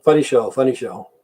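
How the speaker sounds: normal talking voice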